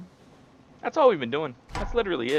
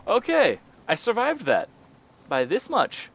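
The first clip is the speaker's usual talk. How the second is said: breathless relief; 11 semitones higher, 5 dB louder than usual. Real, not acted. Relief after escaping.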